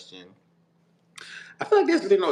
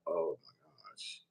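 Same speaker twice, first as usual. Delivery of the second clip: shocked hush; 6 semitones lower, 12 dB quieter than usual. Retold or imitated. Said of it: "so I was like".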